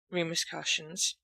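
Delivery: ordinary delivery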